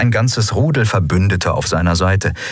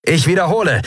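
high, urgent repeat call; high-pitched, insistent hail; 10 semitones higher than usual. A narrator's reading. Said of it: "rief Sephiron in eines der Sprechrohre".

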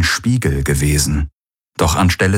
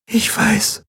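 tender Key. Acted. Key answers softly.

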